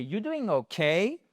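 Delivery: 'okay' lifted high at the end; rising question tune. Shown as a demonstration. Teacher showing yes-no intonation, voice rising on 'okay'. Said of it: "donc vous remontez".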